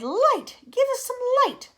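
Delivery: high, loud pleading cry; cried out. Acted. The creatures crying out for light in darkness. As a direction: beg loudly for light. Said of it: "they cried".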